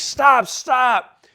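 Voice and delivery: hurt and pleading at full volume; pained yell. Retold or imitated. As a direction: yell back to make her stop. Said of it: "I'm yelling back".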